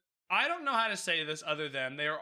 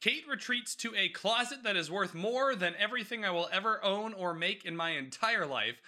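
ordinary delivery

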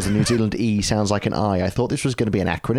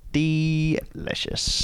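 savoring; 7 semitones higher, 3 dB quieter, much slower than usual. Real, not acted. Savoring the beer.

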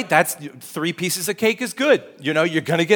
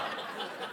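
breathy laugh; 18 dB quieter than usual. Real, not acted. Laughing after the bit.

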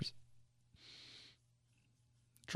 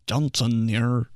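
mock newsman; slower than usual. Newsman persona.

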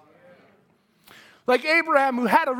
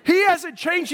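normal talking voice

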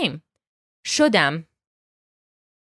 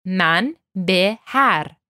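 Man be har, each word drawn out slowly; slow model phrase; much slower than usual. Shown as a demonstration. Teacher slowly models the phrase for students. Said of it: "We're having a lot of one-syllable words".

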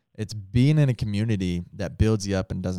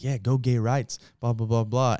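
mock yelling woman. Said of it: "she's, like, yelling at me".

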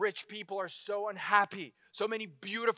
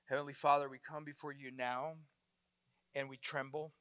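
hushed prayer; 8 semitones lower, 12 dB quieter than usual. Real, not acted. Preacher leads the congregation in prayer, soft and reverent. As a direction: pray gently before God.

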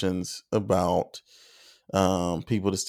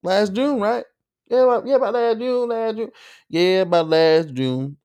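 much higher mock voice; 12 semitones higher than usual. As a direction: jump way up in pitch on last June. Mock country guy recalling.

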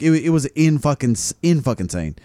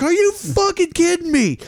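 much higher, ranting yell; retold yelling. To the creep running girls off, high ranting yell. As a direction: tear into him, voice pitched way up. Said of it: "I was like".

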